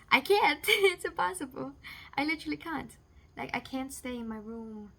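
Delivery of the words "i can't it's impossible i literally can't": loud, high burst of frustration with a laugh; louder, laughing exasperation; exasperated outburst. Real, not acted. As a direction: blurt it out, fed up but laughing. Exasperated outburst about being stuck.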